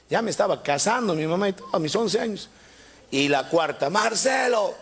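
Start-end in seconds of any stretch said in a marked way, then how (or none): 3.9-4.7 hammed-up mother calling out; mock call out. Mother calls Marcelo a fourth time, mock shout.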